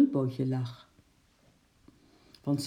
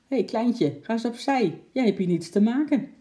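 louder, higher stern policeman; stern calling; 7 semitones higher, 5 dB louder than usual. Acted. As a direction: wave the little girl off. Policeman pushing a girl back.

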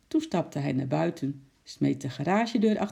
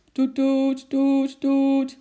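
loud, high repeated honks; toeter nadoen; 10 semitones higher, 7 dB louder, much slower than usual. A narrator's reading. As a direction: imitate a blaring car horn. Toeter klinkt plotseling weer. (luid, hoog herhaald getoeter)

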